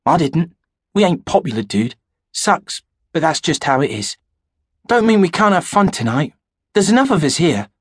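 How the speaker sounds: easygoing teenage boy, lightly played; teen buddy Daniel. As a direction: shrug it off like a mate who doesn't care. Narrator voices Daniel.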